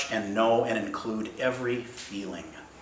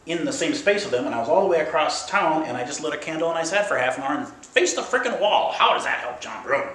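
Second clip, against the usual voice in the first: frustrated mind; 3 semitones higher, 5 dB louder than usual. Acted. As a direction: protest like a mind demanding concrete proof. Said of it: "that's frustrating to our linear minds".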